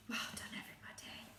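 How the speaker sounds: quick hushed praise; whisper. Real, not acted. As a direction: whisper it fast and proud.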